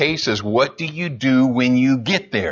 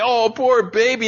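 high, pitying coo; 7 semitones higher than usual. Retold or imitated. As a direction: coo it to her in mock sympathy. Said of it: "I can make her cry".

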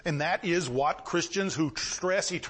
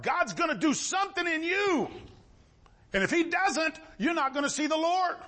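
fervent preaching; 9 semitones higher than usual. Real, not acted. Preacher warning the congregation he must change them, shouting high. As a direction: drive it home to the congregation full voice.

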